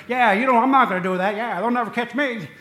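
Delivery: cocky gangster brag, loud and high; Jimmy Cagney impression. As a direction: sneer it like a thirties movie gangster. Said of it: "that's how Jimmy Cagney talks".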